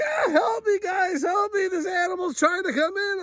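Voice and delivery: very high, crying shout of panic; imitating a friend screaming in fear; terrified cry. Friend begs for help, terrified.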